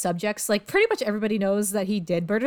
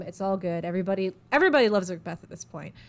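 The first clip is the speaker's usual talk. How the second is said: normal talking voice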